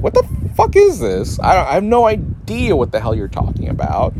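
high, incredulous disbelief; puzzled viewer losing patience; baffled outburst. Retold or imitated. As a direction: blurt it out in total confusion. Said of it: "and I'm like".

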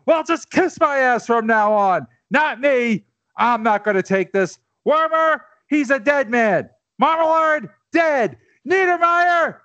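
high, shouted defiance; roaring rallying speech. Acted. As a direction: roar the names out. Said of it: "famous speech".

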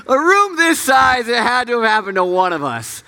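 high, laugh-choked words. Real, not acted. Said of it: "I don't know why I'm laughing".

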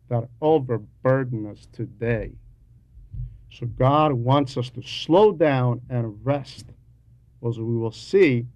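normal talking voice

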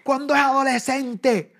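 high, loud exasperated cry; imitating wife's exasperated shout; mock wife yell. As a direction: rant at the teenager. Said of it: "porque dice".

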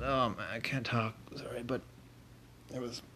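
rough voice, breath catching midword; strained voice. Real, not acted. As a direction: talk through a strained throat. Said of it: "voice is kinda messed up".

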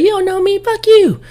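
very high mock female voice; mimicked offended woman. Woman curses him out before her friends, mock-indignant falsetto.

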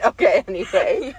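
normal talking voice

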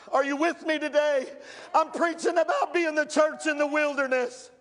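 rising, shouted preacher cadence; full-force pulpit preaching. Acted. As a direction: preach it hard to the congregation.

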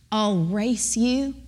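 put-on very slow English-accented granny; grandmother's thick English accent. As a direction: draw it out like an old English lady. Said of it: "speaks with a thick accent".

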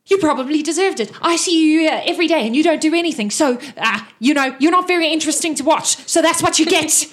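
high, loud, fast put-down; cheeky character mockery; snarky retort. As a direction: dismiss him with a sneer. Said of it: "and he says".

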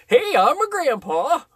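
high, slow mocking grandpa; mock grandpa announcement. Mimicking the child's grandpa, high and drawn out. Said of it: "You don't need to be like".

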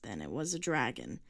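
put-on low, curt old man's voice. A narrator's reading. Old man correcting the girl, low and curt. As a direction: cut it short. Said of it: "he said curtly".

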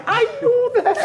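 high, laughing self-reproach; rueful laughing realization; frustrated laugh. Retold or imitated. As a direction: kick yourself with a laugh.

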